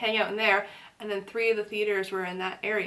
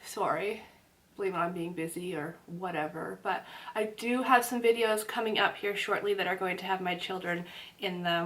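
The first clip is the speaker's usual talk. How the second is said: ordinary talk, nothing marked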